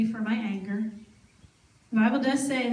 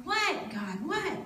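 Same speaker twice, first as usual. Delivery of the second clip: higher, fretful plea to be left alone; exasperated pleading; 5 semitones higher than usual. Retold or imitated. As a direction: whine at God to let her stay mad.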